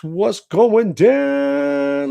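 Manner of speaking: high, loud, very drawn-out holler; hyped shout-out. Real, not acted. Streamer greeting live viewers and likes, excited holler. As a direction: stretch the last word into a long whoop.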